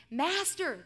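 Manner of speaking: drawn-out call for the master; dramatised cry for attention; storybook shout. A narrator's reading. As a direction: call out urgently, stretching the word. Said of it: "they shouted".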